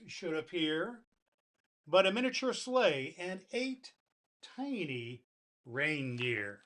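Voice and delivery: lively storybook recital with dramatic pauses; poem reading. Acted. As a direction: read it like a bedtime poem to children. Reads the classic poem aloud.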